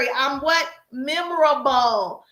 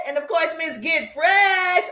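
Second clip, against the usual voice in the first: hype shout-out; 2 semitones higher, slower than usual. Real, not acted. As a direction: call out each name like a hype host. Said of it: "shouting out live viewers".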